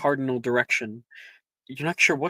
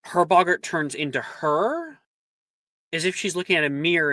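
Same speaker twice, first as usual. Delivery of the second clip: ordinary delivery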